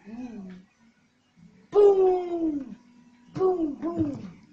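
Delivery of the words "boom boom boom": loud high booms; excited shout. Real, not acted. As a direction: celebrate loudly. Celebrating in game.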